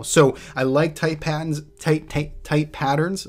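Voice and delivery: Boston accent, dropped r in patterns. Real, not acted. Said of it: "as my Boston accent, I think, came out there".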